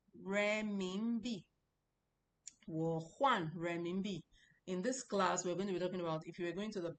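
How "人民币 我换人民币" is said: very slow "人民币", each syllable drawn out; slow Chinese model line. Shown as a demonstration. Teacher introducing the lesson to class, slow model line. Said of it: "we're talking of".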